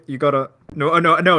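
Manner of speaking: ordinary talk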